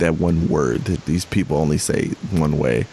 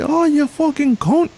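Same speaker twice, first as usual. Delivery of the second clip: much higher, shrill British cursing; mock British road rage; 3 dB louder than usual. Imitating British dashcam cursing for his co-host, high mock rage. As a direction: screech it like an angry British driver. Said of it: "montage of British people cursing".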